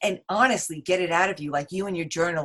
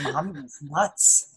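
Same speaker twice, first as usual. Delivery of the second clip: slow, laughing mock verdict; amused imagined verdict; 3 semitones lower, slower than usual. Guest voicing her kids reading her journals, laughing. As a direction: draw it out and break into laughter.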